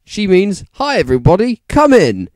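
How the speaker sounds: loud, high, overriding welcome; blaring. A narrator's reading. Dumbledore blaring a welcome over Rumbridge. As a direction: drown her out with a cheery welcome. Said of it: "Dumbledore blared at her".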